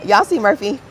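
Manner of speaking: high, laughing as she shows the dog; laughing to chat. Real, not acted. Streamer showing her dog to viewers, laughing. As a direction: giggle while pointing out the puppy.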